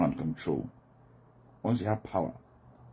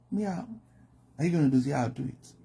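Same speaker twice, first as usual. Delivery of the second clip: high, sing-song yes that means no; a mock breezy yes; fake agreement; 8 semitones higher than usual. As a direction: agree sweetly without meaning a word of it. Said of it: "Then there is passive-aggressive".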